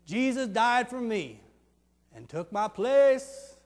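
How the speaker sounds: slow, weighty delivery; slow emphatic line. Acted. Barabbas telling customers his story, slow and solemn. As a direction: let each word land slowly. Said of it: "You hear this one?".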